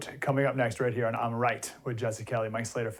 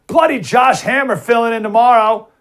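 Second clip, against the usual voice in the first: high, loud hammed-up British accent; playful fake British sign-off; mock British accent; 8 semitones higher, 9 dB louder than usual. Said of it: "I'll keep the British accent to Jesse".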